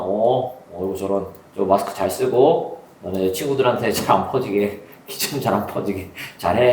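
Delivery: consoling teacher. Retold or imitated. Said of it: "그러면서는".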